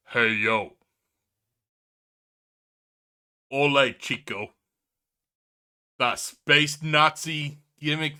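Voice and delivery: a deliberately lousy wrestler-tough-guy take; Scott Hall impression. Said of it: "the shittiest Scott Hall impression".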